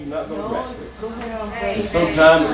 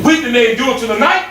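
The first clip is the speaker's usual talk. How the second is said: rising gospel exhortation; building preaching shout; 9 semitones higher than usual. Real, not acted. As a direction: preach it up to a full cry.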